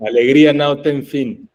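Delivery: bright laughing Portuguese; Portuguese phrase. Shown as a demonstration. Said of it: "lo único que sé decir en portugués".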